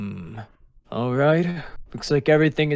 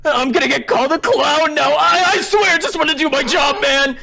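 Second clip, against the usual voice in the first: very high, whining panic; acted fretful, high-pitched angel; anxious panic; 11 semitones higher, 3 dB louder than usual. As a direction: wail as if doomed. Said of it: "the easily anxious angel".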